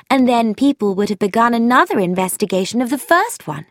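brisk eager objection; young woman voiced, keen and quick; Frankie, eager.

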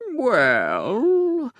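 long drawn-out rising-falling Well; slow well. A narrator's reading. Narrator slowly considers the official's request. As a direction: stretch Well out thoughtfully. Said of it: "I said slowly".

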